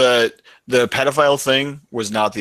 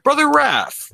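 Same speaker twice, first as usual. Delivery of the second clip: missionary calling out; lightly voiced door knocker; high polite call; 4 dB louder, slower than usual.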